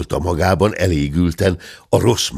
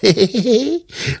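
high chuckle. A narrator's reading. Hörpentő alone in the room, giggling high.

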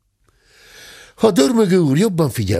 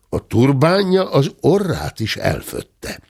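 plain reading voice

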